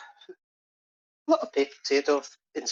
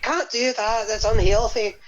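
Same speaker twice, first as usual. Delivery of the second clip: high mock Irish mam protesting; voicing the mother; 7 semitones higher, 3 dB louder than usual. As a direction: play the fussy mam, pitched up. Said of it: "And then the ma's like".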